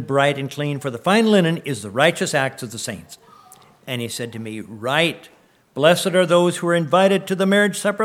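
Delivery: fervent, ringing Bible reading; scripture reading. Acted. Pastor reading scripture to his church, fervent and ringing. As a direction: proclaim the passage to the congregation.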